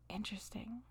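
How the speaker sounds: barely audible whispered aside; musing whisper. Retold or imitated. Retelling her reaction to God's message, whispered musing. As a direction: whisper it to yourself, mulling it over.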